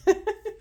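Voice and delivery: high, short laugh after tears; light laugh. Real, not acted. Laughing at herself after crying.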